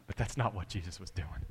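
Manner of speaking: ordinary talk, nothing marked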